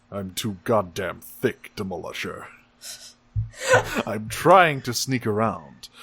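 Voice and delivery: hammed-up heavy-villain lament; mock villain voice. Acts Megatron complaining to Demolisher.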